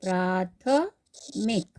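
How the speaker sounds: प्राथमिक very slowly, long प्रा; slow model answer. Shown as a demonstration. Teacher answers her own question, saying प्राथमिक slowly. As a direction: drag out प्राथमिक syllable by syllable. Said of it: "क्या बनेगा?".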